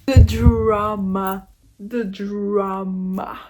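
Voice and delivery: very slow, drawn-out words; savouring the scandal. Real, not acted. Reader relishing the characters' scandal, slow and theatrical. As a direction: stretch it out theatrically.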